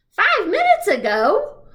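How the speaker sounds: high, loud, slow astonished echo; surprised. Acted. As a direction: repeat "Five minutes ago?" in shock. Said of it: "He looks really surprised".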